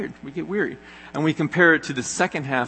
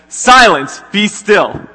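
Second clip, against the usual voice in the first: big loud rebuke, high and booming; commanding shout; 7 semitones higher, 11 dB louder, slower than usual. Retold or imitated. Jesus rising to silence the storm, booming commanding shout. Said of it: "But then Jesus gets up and he says".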